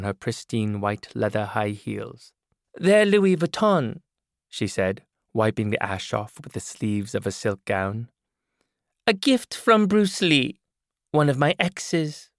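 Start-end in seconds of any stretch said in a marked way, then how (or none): 2.7-4.0 slow, higher, louder showing off; woman brags about her shoes, slow and high; boastful woman character. Acted. 9.1-12.3 slow, higher, louder showing off; woman brags about her shoes, slow and high; boastful woman character. Acted.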